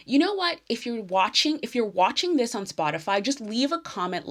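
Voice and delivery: excited idea. Real, not acted.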